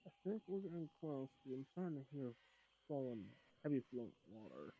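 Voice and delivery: muffled behind a hand; talking through covered mouth; hand over mouth. Real, not acted. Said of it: "Sounds like you're talking into your hand that's covering your mouth".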